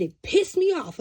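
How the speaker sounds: high and loud, annoyed; indignant outburst. Retold or imitated. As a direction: blurt it out still irritated. Recalling being angered.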